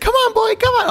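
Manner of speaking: very high coaxing call; mock high coaxing. Imitating Rebel Wilson handing the boy a grenade, high coaxing call. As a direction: coax him like a dog, high up.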